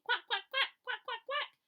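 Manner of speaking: high, loud laughing duck quacks; loud happy quacking. A narrator's reading. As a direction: quack gleefully in circles. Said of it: "quacking loudly".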